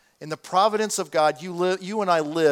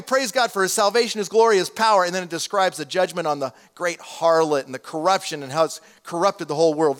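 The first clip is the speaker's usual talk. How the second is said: ordinary talk, nothing marked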